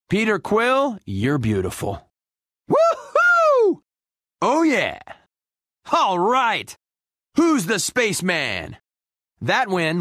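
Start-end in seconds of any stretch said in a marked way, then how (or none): 2.7-3.8 very high, loud "woohoo"; victory whoop. Acted. Winner celebrating, jubilant whoop. 4.4-6.7 high, pleased exclamations; triumphant cheer. Acted. Winner cheering himself on, triumphant. Celebrates a win.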